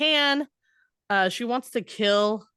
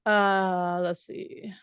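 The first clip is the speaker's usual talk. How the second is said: muttering to self; much slower than usual. Real, not acted. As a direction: murmur while looking for your place. Searching her notes, to herself.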